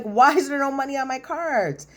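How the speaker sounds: high-pitched mock outrage; retold indignant outburst. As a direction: imitate a husband's incredulous protest. Husband's outburst over empty cards.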